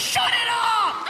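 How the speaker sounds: high, drawn-out shout; acted loud squealed plea; hysterical shout. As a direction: yell it way up high while cracking up. Cop at window, helpless with laughter.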